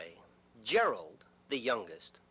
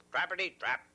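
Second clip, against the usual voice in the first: ordinary delivery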